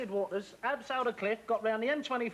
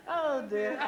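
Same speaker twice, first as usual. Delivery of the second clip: laughing oh dear; 6 semitones higher, 4 dB louder, slower than usual. Real, not acted.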